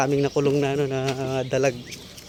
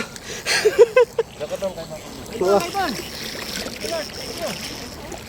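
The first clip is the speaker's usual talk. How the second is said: high laugh that runs on; long laughter; 13 semitones higher, 4 dB quieter than usual. Real, not acted. Fishermen amazed at the spot, long laughing. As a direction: keep laughing, high and giddy.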